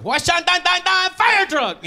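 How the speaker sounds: high, slow mock Japanese accent; a lightly hammed Japanese accent singsong.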